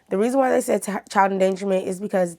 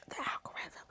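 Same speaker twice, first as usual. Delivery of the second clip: whisper; 21 dB quieter than usual. Acted. Whispered aside to the viewers.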